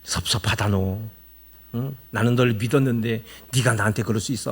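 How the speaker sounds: hurt sulk. Retold or imitated. Sulking, hurt friend.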